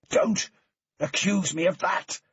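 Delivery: loud, high angry shout; furious shout. A narrator's reading. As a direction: yell it in his face. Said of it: "Matt shouted".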